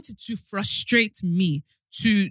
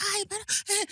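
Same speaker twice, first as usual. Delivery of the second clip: high, softer mock exclamation; staff woman's astonishment; 12 semitones higher, 5 dB quieter, faster than usual. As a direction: imitate her delighted recognition, pitched way up. Imitates woman reacting to hometown.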